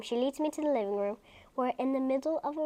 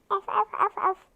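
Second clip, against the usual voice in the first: puppy barking; 7 semitones higher, 4 dB louder than usual. Acted.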